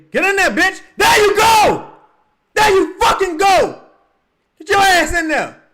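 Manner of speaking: screams high and loud at the shot; real cheering shout. Real, not acted. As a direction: yell him home from the couch. Cheering a made shot live.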